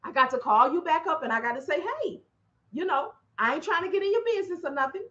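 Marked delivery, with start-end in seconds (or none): 1.8-5.1 soft cautious tone. Retold or imitated. Retold careful phone call.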